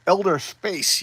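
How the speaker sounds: usual speaking voice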